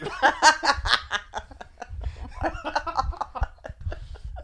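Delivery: high laughter running on; real helpless laughter. Real, not acted. Storyteller losing it over spitting in a closet, helpless laughter. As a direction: crack up and keep laughing.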